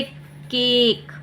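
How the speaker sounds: केक with a stretched "ay"; long A sound. Shown as a demonstration. Teacher adding an example, केक with long "ay". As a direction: draw out the vowel in केक. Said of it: "इसे ए ही पढ़ेंगे".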